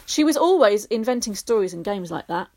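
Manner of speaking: ordinary delivery, nothing marked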